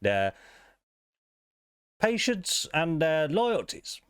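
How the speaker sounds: a stiff, high-pitched card dealer; dealer character. Acted. Game master voicing the dealer to the player, high and formal.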